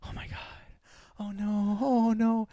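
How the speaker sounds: hushed mock gasps of dismay; imitating anxious moviegoers; imitated worried audience. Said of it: "I hear people in the theater going".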